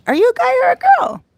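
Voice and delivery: teasing question. Real, not acted. Teasing a commenter.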